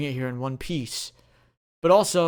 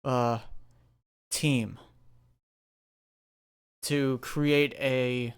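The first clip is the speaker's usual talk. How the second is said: formal proclamation. Acted. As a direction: proclaim it like an official, with weight. Commissioner proclaims to the crowd.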